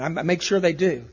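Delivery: ordinary talk, nothing marked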